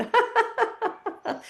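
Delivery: long, high forced laughter; deliberate high cackling laugh; high exercise laugh. Shown as a demonstration. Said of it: "Clean it with laughing".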